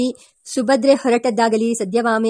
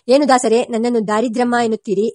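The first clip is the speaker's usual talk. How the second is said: ordinary delivery, nothing marked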